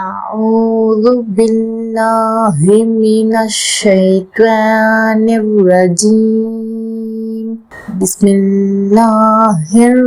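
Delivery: slow, drawn-out Quran chanting; tajweed recitation. Shown as a demonstration. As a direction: stretch every vowel, enunciate each letter. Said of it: "எப்படி தஜ்வீதோட ஓதணும்னு".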